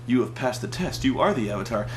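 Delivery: low, soft calm pronouncement; mock calm sage. Calm bearded elder to the Avatar, lightly done mock calm. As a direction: speak like a serene bearded elder. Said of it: "he's like".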